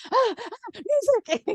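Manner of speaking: very high, flustered yelp; panicked squeal. Retold or imitated. DJ scrambling behind the scenes, squealing high for music. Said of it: "I'm like".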